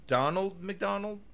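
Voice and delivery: "Donald McDonald" drawn out slowly, rising like a question; slow puzzled "Donald McDonald" with a questioning lift. Shown as a demonstration. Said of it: "it's just Donald McDonald".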